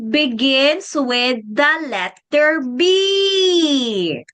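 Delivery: very drawn-out, ending on a long letter name; slow teaching question. Shown as a demonstration. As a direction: stretch it out for a little learner. Teacher stretches question for child.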